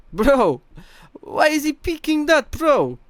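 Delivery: very high, loud mimicry of a teammate; Chronicle impression. Streamer mimicking teammate questioning a pick, high loud caricature. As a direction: shoot way up in pitch, an exaggerated imitation. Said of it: "that one I'm quite good at".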